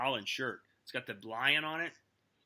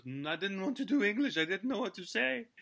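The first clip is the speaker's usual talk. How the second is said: mock French accent; 5 semitones higher than usual. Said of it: "He goes".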